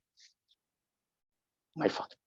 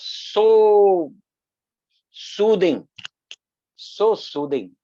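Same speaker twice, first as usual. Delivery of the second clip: slow, drawn-out, higher and louder so; savouring; 8 semitones higher, 6 dB louder, much slower than usual. Real, not acted. Devotee recalling the train bhajans, slow and savouring. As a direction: linger on every word as if still hearing the bhajans.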